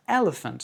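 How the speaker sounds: English "elephant", EL strong, rest reduced; stress on first syllable. Shown as a demonstration. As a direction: lean on EL in "elephant". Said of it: "accent sur la première".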